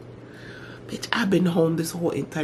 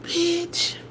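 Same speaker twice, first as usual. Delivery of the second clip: giddy delight; 5 dB quieter, slower than usual. Retold or imitated. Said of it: "I was like".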